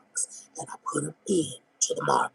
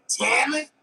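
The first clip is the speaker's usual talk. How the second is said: mocking neighbor call; 10 semitones higher than usual.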